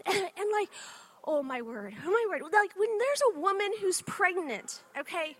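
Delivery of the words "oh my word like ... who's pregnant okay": ordinary delivery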